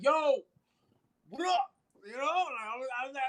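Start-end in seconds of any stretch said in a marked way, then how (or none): 0.0-1.7 high, loud mock challenge; high-pitched bravado. Dreamer greets his opponent in the fight, high-pitched bravado. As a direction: call it out high and cocky.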